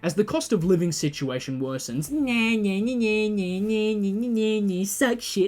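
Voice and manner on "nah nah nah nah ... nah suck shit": higher mocking singsong; nah-nah it like a playground taunt. Australians taunting Americans, higher mocking singsong.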